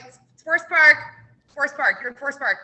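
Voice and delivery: loud, insistent repeat of Forest Park; loud then quick exasperated repeat; exasperated correction. Retold or imitated. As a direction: spell it out to someone who won't listen. Staff correcting the caller.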